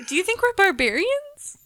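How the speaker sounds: high, indignant "barbarians?"; mock outrage. Real, not acted. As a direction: play up the shock. Mock outrage at cow tipping.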